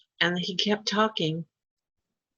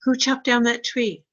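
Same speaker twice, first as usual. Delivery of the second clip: demanding question; 6 semitones higher, 5 dB louder than usual. Retold or imitated. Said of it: "Rick turned to me and goes".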